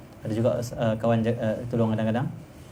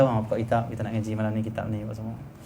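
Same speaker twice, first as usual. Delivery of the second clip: softer, relaxed invitation; nice, gentle asking; 4 semitones lower, 7 dB quieter than usual. Shown as a demonstration. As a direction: ask students kindly to open the book. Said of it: "Cakap elok masa dah suka". (cakap elok dan lembut)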